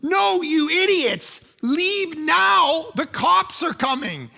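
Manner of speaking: high, slow, emphatic yelling; acted-out frantic, drawn-out shout; exasperated shout. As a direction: yell in alarm at pranksters who won't listen. Retold panicked yelling at kids.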